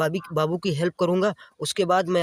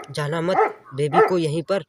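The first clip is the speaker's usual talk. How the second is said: ordinary talk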